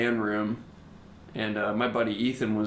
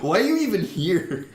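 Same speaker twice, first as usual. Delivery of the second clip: mock dismissive; 8 semitones higher, 4 dB louder than usual. Retold rude dismissal.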